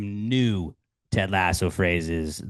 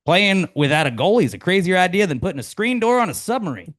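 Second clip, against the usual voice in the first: higher, louder folksy quip; Ted Lasso quote; 9 semitones higher, 4 dB louder than usual. Acted. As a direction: pitch it like a cheery coach.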